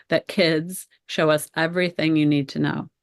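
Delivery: usual speaking voice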